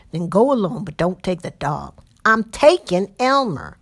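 loud, impatient bickering night rider; two rough men squabbling over the dog; coarse patroller voice. Acted.

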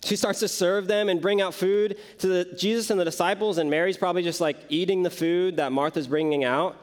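usual speaking voice

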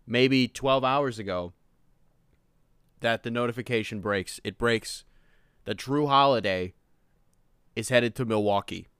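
ordinary talk

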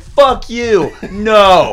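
loud, high mock refusal; imitated drunken shout. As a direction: yell like a drunk actor refusing. Drunk voice actor shouting in studio.

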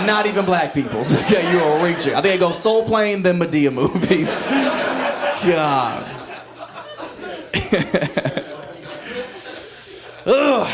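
ordinary delivery

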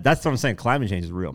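ordinary talk

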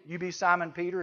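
normal talking voice

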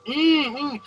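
high hummed revving bursts; imitated engine hum. Neighbor's mower mocked with high hums. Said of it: "they go like this".

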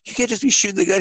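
high, fast put-on voice; mock old guy. Old guy telling the coach off, fast high mock voice. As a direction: scold him in a silly high voice. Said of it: "He's like".

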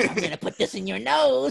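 mock shady doctor through laughter. Friend acting out a shady doctor with a swab, hammed-up and laughing. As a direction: play a creepy doctor, laughing. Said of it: "like a little shady doctor".

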